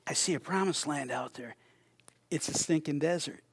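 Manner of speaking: see only the bleak desert, flatly; gloomy vision. Shown as a demonstration. Preacher voicing a pessimist's view, softly deadpan. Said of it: "My vision is different than yours".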